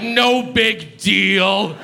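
very slow, high mock reply; slow imitation of a disarmed cyclist; acted forgiveness. Cyclist's response to the apology.

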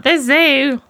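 mock enthusiasm. Dater mimicking everyone's first-date answer, with mock enthusiasm. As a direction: imitate everyone's eager, predictable reply.

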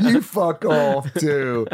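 loud, drawn-out pretend comeback; hammed-up yell back, very slow; mock defiant shout, stretched out; mock retort. Acts son swearing back at mum.

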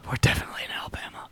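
fast, breathy whispered echo. Acted. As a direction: whisper it back, quick and secret. Echoing partner in a whisper.

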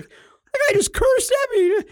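very high, slow hammed-up whimper; mock whine. Bill complaining about the old man, a high, slow mock whimper. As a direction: whimper like a hurt big kid.

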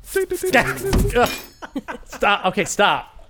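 giggling stop while being tickled; laughing protest. Real, not acted. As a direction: squeal it out through laughter.

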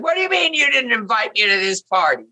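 theatrical British indignation, high and projected; Lynn Redgrave. Retold or imitated. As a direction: play it loud and British, a staged quarrel. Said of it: "With her British accent, really loud".

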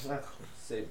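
usual speaking voice

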